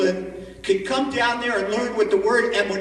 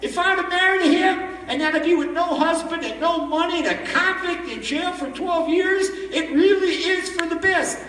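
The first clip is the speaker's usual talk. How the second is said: high, louder, tearful relief laughing through it; crying and laughing; 9 semitones higher, 4 dB louder than usual. Retold or imitated. Barmaid thanks God after seeing the newspaper, high tearful laughing relief. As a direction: thank God while half sobbing, half laughing. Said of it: "and she's crying, and she's laughing".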